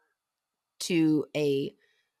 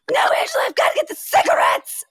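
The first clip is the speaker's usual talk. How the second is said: very high loud crying shout; Sally screaming; 6 dB louder than usual. Retold or imitated. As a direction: shriek it like a horror heroine. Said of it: "I was Sally screaming to go get the cigs".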